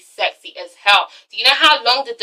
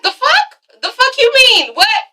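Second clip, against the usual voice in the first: much higher, incredulous mock outrage; shocked outrage; 4 semitones higher than usual. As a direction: squeak it out like a stunned fiancé. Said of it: "And he's like".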